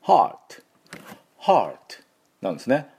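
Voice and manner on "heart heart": drawn-out open "haa" in "heart", ending on t; English heart pronunciation. Shown as a demonstration. Teacher showing viewers how heart is pronounced, English pronunciation. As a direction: say "heart" as one English syllable, no "he". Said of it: "Heって発音しません".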